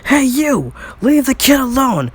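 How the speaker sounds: high, loud call-out; yell. Acted. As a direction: yell at bullies from across the yard. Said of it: "Baku would yell at them".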